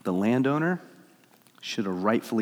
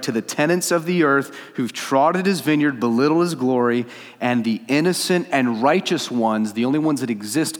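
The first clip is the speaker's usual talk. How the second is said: forceful, emphatic proclamation; raised preacher's climax; impassioned preaching; 3 semitones higher, 4 dB louder than usual. Real, not acted. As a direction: drive each phrase home loudly.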